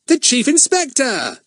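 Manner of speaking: high, slow relieved exclamation; thankful cry. A narrator's reading. Fatty hears rescue coming, a high thankful cry. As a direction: let the relief pour out slowly. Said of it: "said Fatty thankfully".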